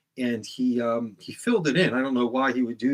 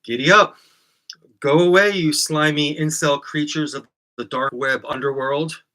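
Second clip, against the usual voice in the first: loud, higher jeering send-off, slowing on the insult; loud mocking greeting; 3 semitones higher, 6 dB louder than usual. Shown as a demonstration. Host greeting his viewers with a loud mocking dismissal. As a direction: shoo the trolls away with a loud sneer. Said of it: "So I say".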